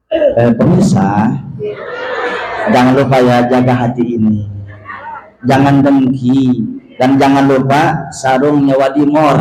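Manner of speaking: mock TV preacher voice. Shown as a demonstration. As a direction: put on a polished on-air tone. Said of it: "nih begini nih kalau lagi syuting".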